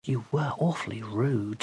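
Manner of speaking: soft, timid reproach; quiet reproach. Acted. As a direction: tell her off gently. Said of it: "said Kat".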